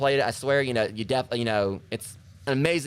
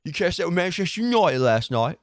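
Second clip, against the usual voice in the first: higher, louder northern English bloke; a higher Manchester accent; Mancunian accent; 7 semitones higher, 5 dB louder than usual. Retold or imitated. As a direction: chat about footy like a Manchester lad. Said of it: "voice I do for Pin Dad and Puppet Master".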